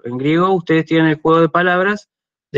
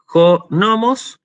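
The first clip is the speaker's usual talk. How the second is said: slow "Jonomos", higher, stressed jo-NO-mos; stress on first omicron; 4 semitones higher, much slower than usual. Shown as a demonstration. Teacher warning students not to confuse it, "Jonomos" stressed jo-NO-mos. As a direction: draw out "Jonomos" with the accent on NO. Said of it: "con acento en la omicron".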